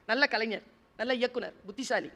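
normal talking voice

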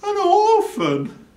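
pitying burglar.

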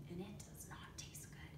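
hushed, secretive whisper. Acted. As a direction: tell it like a secret. Secretive aside about E.

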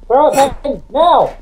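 high, urgent call to a teammate; urgent command. Real, not acted. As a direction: urge them to act this instant. Urging teammate to throw it now.

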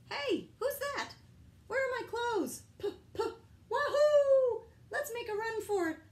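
high, loud squeaky crayon chatter; giddy high cartoon voices; excited crayons. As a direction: tumble out like excited little crayons.

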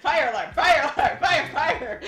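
mock alarm. Acting out burning mouth.

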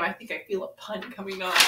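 ordinary talk, nothing marked

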